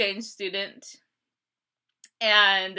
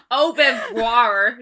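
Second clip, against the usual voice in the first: French drawn out in a loud American accent; mock American French, slow and laughing; exaggerated American accent; 6 dB louder, slower than usual. Shown as a demonstration. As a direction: say it as American as you can. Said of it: "as American as you can".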